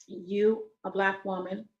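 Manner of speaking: ordinary talk, nothing marked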